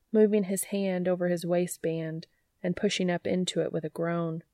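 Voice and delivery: plain reading voice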